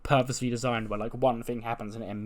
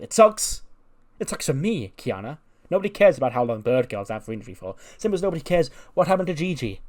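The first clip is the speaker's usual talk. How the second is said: much higher and louder on it sucks; indignant outburst; 4 semitones higher, 6 dB louder than usual. Retold or imitated. Becky snapping at Kiana that it sucks for her, loud and high. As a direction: snap it back, all about yourself. Becky snaps back selfishly.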